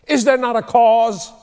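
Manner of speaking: loud, high rallying question; ringing challenge. Acted. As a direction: call it out boldly. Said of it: "he goes".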